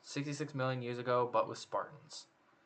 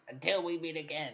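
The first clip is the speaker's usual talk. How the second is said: lightly imitated cartoon villain farewell; a hint of a high-pitched villain; Skeletor; 3 semitones higher, 4 dB quieter than usual. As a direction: toss off a mock villain sign-off. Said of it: "Where Skeletor shows up and just says something".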